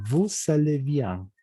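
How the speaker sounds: slow "vous allez bien" with the S of "vous" heard before "allez"; liaison S pronounced. Shown as a demonstration. Teacher demonstrates liaison before a vowel, "vous allez bien" with sounded S. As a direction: link "vous" into "allez" with an S, slowly. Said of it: "la S se pronuncia".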